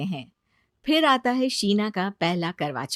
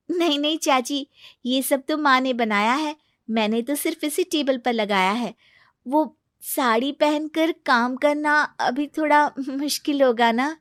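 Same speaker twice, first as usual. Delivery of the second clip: lightly voiced apologetic bride; meek young bride; 5 semitones higher than usual. New bride admits her mother-in-law did the cooking, a touch meek. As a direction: explain yourself modestly.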